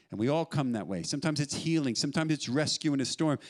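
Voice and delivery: ordinary talk, nothing marked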